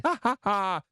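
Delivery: loud, high mock laugh; three big staged ha-ha-has; fake laugh. Shown as a demonstration. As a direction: laugh it out loudly and falsely. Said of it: "I'd be laughing about this".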